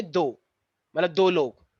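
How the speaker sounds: ordinary talk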